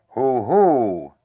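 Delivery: loud, gloating hoot; gloating heffalump. Acted. Bear imagines what the heffalump will say, gloatingly. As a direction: boom out a gloating mock hoot. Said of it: "in a gloating sort of way".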